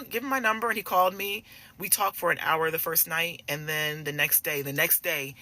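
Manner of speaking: normal talking voice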